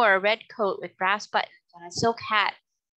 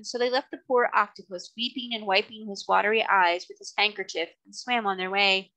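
ordinary delivery

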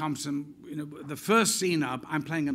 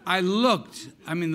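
ordinary talk

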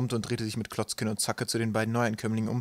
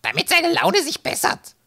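very high, loud, slow put-on voice; quoted hostess voice; 9 dB louder, slower than usual. A narrator's reading. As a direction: squawk it slowly as a caricatured hostess. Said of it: "wie sie zuvor viel cool gesagt hatte".

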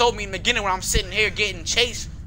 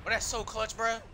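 normal talking voice

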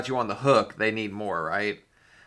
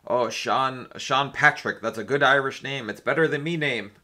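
put-on a shaky, half-hearted Irish accent; mock Irish lilt, badly done; butchered Irish accent; 4 semitones higher, 4 dB louder than usual. Streamer fakes an Irish accent for a message, badly. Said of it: "me butchering an Irish accent".

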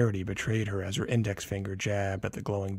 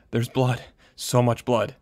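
panicked; 3 semitones higher, 6 dB louder than usual. A narrator's reading. Panicked mother.

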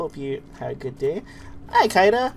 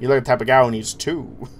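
Johnny Bravo voice; 5 dB louder than usual. Retold or imitated. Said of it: "Johnny Bravo was fun".

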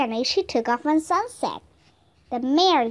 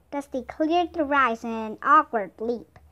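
usual speaking voice

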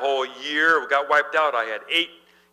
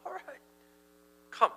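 much softer, hushed "come"; soft invitation; 13 semitones higher, 12 dB quieter than usual. Acted. Jesus inviting Peter onto the water, hushed. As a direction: draw them in gently. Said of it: "And Jesus says".

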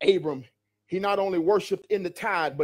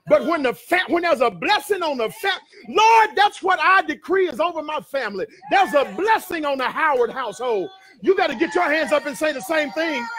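high, shouted sermon climax; full-force preaching; 7 semitones higher, 5 dB louder than usual. Real, not acted. Preacher rousing the church to declare they're blessed, full-force preaching. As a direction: drive the congregation to shout along.

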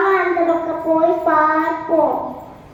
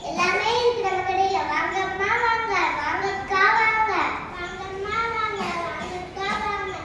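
singsong street hawker; 8 dB quieter than usual. Acted. Shopkeeper calling customers.

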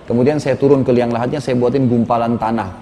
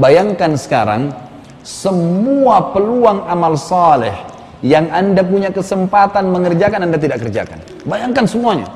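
impassioned preaching; 4 semitones higher than usual. Acted. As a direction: press the regret onto him like a preacher. Imploring the man lying in shroud.

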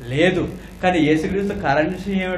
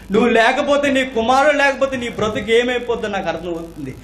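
high, raised, urgent preaching voice; impassioned reassurance; 8 semitones higher, 6 dB louder than usual. Acted. Christ tells the widow he understands, impassioned and raised. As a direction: press home that you understand.

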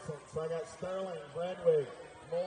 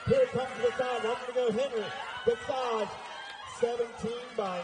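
rising, louder play-by-play as the finish nears; excited race call; 3 semitones higher, 6 dB louder than usual. Real, not acted. Announcer calling a tight finish to the crowd, excited and loud. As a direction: call the drag race home, building to a shout.

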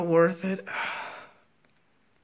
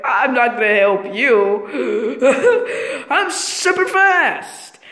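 laughing taunt; 3 dB louder, much slower than usual. Pretend.

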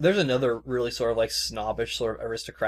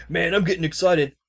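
excited; 6 semitones higher, 6 dB louder than usual. Retold or imitated. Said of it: "he's like".

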